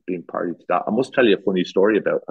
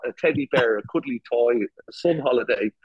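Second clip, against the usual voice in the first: ordinary delivery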